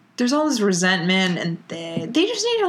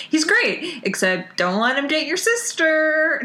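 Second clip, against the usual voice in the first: higher-pitched mocking quote; mock endorsement; 7 semitones higher than usual. Co-host mocks a character's odd tip, mock endorsement. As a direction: parrot the odd advice incredulously.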